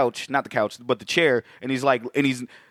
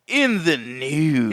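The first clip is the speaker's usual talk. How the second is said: slow mock reveal; much slower than usual. Playful drawn-out joke.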